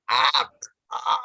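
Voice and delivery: mock straining "Ah, ah" from the throat; strained throat sound. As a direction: force "Ah, ah" out with too much effort. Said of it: "así, hacen todo el esfuerzo".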